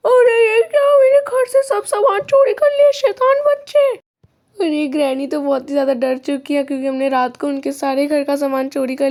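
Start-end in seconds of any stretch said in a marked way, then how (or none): 0.1-3.9 high, loud outraged granny voice; yelling granny. Acted. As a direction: shriek like a robbed granny. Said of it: "अब grandma देखना भी चिल्लाएगी". (चिल्लाती हुई गुस्सैल दादी)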